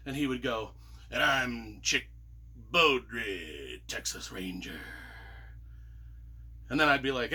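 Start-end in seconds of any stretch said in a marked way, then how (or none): 1.1-4.8 low cowboy introduction; Western hero voice. Retold or imitated. Missionary at the door introducing himself as a low-voiced Texas Ranger. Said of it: "we started talking that way ourselves".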